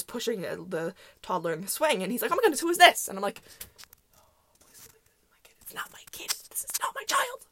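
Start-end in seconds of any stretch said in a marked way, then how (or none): 2.3-3.1 high, loud cooing question; retold exclamation, pitched up; surprised greeting. Friend's dad greets the child. 4.2-7.5 desperate, hushed mock plea; voiceless, hammed-up begging; pleading whisper. Pleading inwardly with friend's dad.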